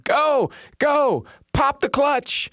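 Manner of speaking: high mock yell to push-start a truck; teen yelling. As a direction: shout it up high like boys pushing a dying truck. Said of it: "Five teenage boys yelling".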